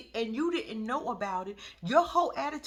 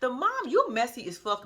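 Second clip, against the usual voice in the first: ordinary delivery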